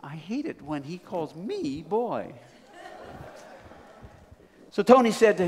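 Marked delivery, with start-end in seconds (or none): 0.0-2.3 slow, quieter private thought; understated slow grumble to oneself; inward grumble. Acted. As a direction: think it aloud slowly, mildly resentful. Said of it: "Tony thought to himself".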